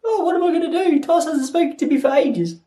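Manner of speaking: high-pitched, put-on lovelorn voice; fretting Siri. Siri pines because Tyson hasn't spoken to her, mock-fretful. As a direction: whine it like a neglected assistant. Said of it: "Siri was just sitting there".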